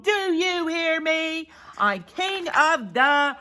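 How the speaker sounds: slow, high, emphatic bullying; snarling demand. Acted. Big Bark lording it over the crowd, snarling. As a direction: demand obedience, word by word. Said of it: "he snarled".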